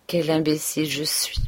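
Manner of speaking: plain reading voice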